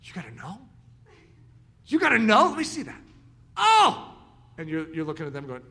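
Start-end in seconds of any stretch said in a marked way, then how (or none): 0.0-0.6 softer, low intrigued question; quiet curious question. Retold or imitated. 1.9-4.2 higher, louder eager delight; light up with eager joy; delighted excitement. Retold or imitated.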